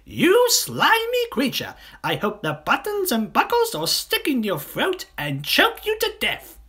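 high-pitched, indignant monkey tirade; angry cry. Acted. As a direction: hurl a shrieky curse at the villain. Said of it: "cried Mugglewump".

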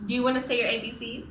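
usual speaking voice

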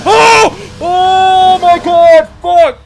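loud frightened yell, drawn out; startled scream. Real, not acted. Real scare, yelling loud at the game. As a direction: scream as the game jumps you.

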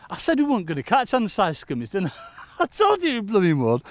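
loud, high-pitched and laughing; joking told-you-so. Real, not acted. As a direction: crow it jokingly, breaking into a laugh. Joking boast about catching undersized fish.

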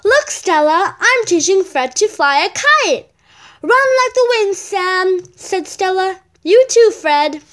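loud, lively read-aloud voice for a picture book; bright storybook reading; reading a story aloud. Acted. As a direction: read it out to the class with energy. Child reads story aloud.

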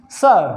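"सर" as suh, vowel held, no r; silent r. Shown as a demonstration. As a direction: stretch the vowel of "सर" and leave off the r. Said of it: "र नहीं बोलना".

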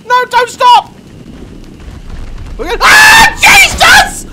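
high, loud frantic screams; panicked screaming. Real, not acted. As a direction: scream while fighting. Attack, panic then fight.

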